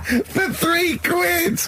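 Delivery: drawn-out, high words squeezed out while cracking up; barely able to speak for laughing; helpless laughter. Real, not acted. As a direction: squeal it through wheezing laughter. Cracks up over the story.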